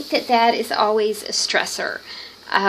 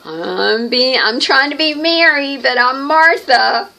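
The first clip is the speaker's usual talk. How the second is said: mock dramatic confession; 5 semitones higher, 3 dB louder than usual. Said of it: "she'll go".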